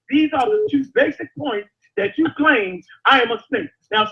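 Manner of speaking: ordinary delivery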